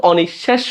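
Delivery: অনিঃশেষ said 'o-nish-shesh'; 'sh' sound in অনিঃশেষ. Shown as a demonstration. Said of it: "বলতেছি সেজন্য তালব্য স".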